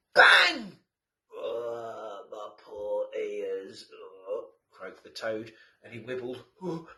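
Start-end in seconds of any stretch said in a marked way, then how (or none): 0.1-0.8 shouted bang, much higher and louder. A narrator's reading. Balloon bursts. 1.3-4.5 lightly voiced toad, slow groan; toad moan. Toad moans after the bang.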